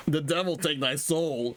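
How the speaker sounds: a touch of a raging shout; mock shout. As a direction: throw a curse with rage. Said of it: "he shouts".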